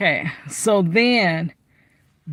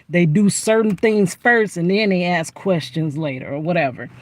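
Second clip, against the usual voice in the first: ordinary talk, nothing marked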